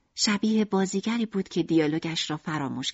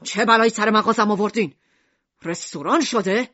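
shouting; 3 dB louder than usual. Acted. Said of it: "داد زد".

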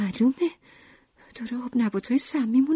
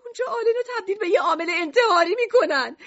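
very high, fast, panicked wail; frantic shouted plea; 13 semitones higher than usual. A narrator's reading. Lucrece begs Mishima not to exile Alan, frantic. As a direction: plead hysterically, racing upward in pitch. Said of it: "رو به میشیما داد زد".

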